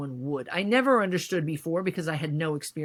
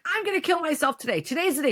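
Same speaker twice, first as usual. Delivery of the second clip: high, fast, theatrical self-mockery; retold overdramatic announcement, mocking herself; mock dramatic declaration; 10 semitones higher, 3 dB louder, faster than usual. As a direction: ham up your own melodrama, pitched up and rushed. Retelling her dramatic threat to therapist.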